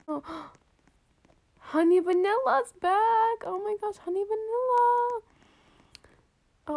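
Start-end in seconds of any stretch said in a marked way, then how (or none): 1.6-5.2 high, thrilled greeting at a friend's return; excited squeal. Real, not acted. Player spotting friend Honey Vanilla back, high excited squeal. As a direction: squeal with joy, slowing on back.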